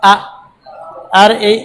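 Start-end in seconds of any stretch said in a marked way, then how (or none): 0.1-0.1 "আ" cut short on a hamza stop; no stretch. Shown as a demonstration. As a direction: say "আ" briefly with a catch, without lengthening. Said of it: "এখানে কোনো টান টান কিছু হবে না". (টান ছাড়া ছোট "আ")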